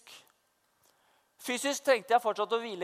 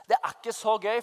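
ordinary talk, nothing marked

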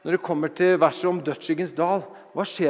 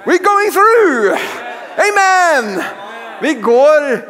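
high, loud, slow rallying cry; preaching at full force; 8 semitones higher, 5 dB louder, much slower than usual. Real, not acted. Preacher rallying the congregation, shouting high and slow. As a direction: belt it out to the congregation. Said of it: "preaching at full force".

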